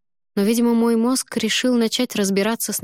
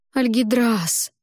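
slow, stretched, higher pleading call of his name; pleading drawl; drawn-out plea; 5 semitones higher, much slower than usual. Acted. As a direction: drag his name out, begging. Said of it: "с мольбой протянула я".

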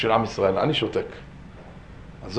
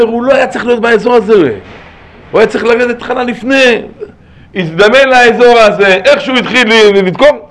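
loud, high-pitched sermon delivery; fervent preaching; 8 semitones higher, 7 dB louder than usual. Real, not acted. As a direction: preach it at full force, voice raised. Preaches at full force. (דרשה נלהבת בקול רם)

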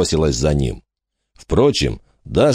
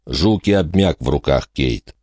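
ordinary delivery, nothing marked

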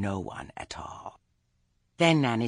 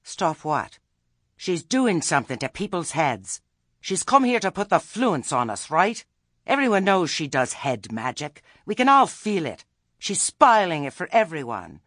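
much higher, louder prim committee voice; officious Lettice; 7 semitones higher, 8 dB louder than usual. A narrator's reading. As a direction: announce the verdict like a self-important chairwoman. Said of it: "said Lettice".

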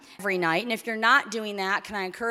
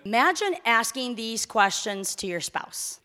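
ordinary talk, nothing marked

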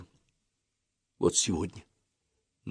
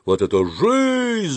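high, drawn-out holler; friends' echoing yell; 11 semitones higher, much slower than usual. A narrator's reading. Friends echo the narrator under the arch, yelling. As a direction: yell it back, soaring on ЖИЗНЬ. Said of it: "заорали мои друзья".

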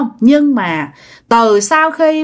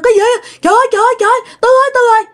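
imitating panicked onlookers, pitched very high; high, urgent retold yelling; crowd's alarmed shouts; 13 semitones higher, 3 dB louder, much faster than usual. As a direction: act out neighbours crying out in alarm. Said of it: "chả nghe mọi người la".

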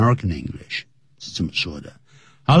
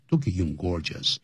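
normal talking voice

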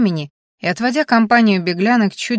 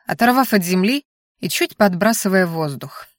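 plain reading voice

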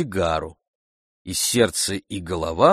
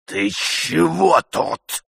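slow, raised, hoarse challenge; 8 semitones higher, 3 dB louder, much slower than usual. A narrator's reading. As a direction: draw it out hoarsely, threatening the intruder. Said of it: "спросил он хрипло".

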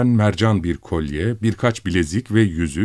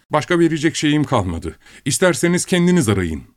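higher, exasperated shout; exasperated cry; 8 semitones higher than usual. A narrator's reading. Pasha shouts at the wife, exasperated. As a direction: fling up your hands and cry out. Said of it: "diye haykırdı".

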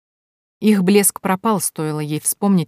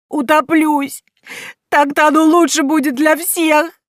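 despairing wail; 11 semitones higher, 6 dB louder, slower than usual. A narrator's reading. Despairing girl wails.

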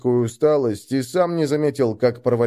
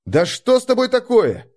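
calling out; 11 semitones higher, 4 dB louder than usual. Acted. Calling after her.

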